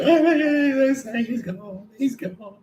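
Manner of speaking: high, drawn-out hammed-up cry; exaggerated high-pitched lament; mock wailing. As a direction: cry out the news like a distraught parent. Mimics parents wailing the news.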